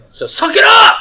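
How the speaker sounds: high, loud mock-tough jab; playful shout. Real, not acted. Teacher teasing students to just accept it, high playful shout. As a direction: bark it out high and joking.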